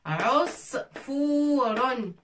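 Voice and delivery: "Usfurun" drawn out very slowly, each sound clear; slow model word. Shown as a demonstration. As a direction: stretch "Usfurun" slowly for learners. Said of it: "O avec l'ain".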